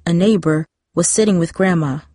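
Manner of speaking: usual speaking voice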